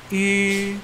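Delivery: "I" held high and level with U lips; rounded ü sound. Shown as a demonstration. Teacher demonstrating mouth shape, high rounded "I". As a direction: say "I" through a pout, drawn out. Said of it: "mulutnya sama seperti U".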